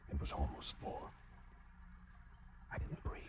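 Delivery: near-silent whispered narration; hushed suspense. A narrator's reading. Killer telling how he crept to the bed, whispering. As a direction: barely breathe the words, creeping closer.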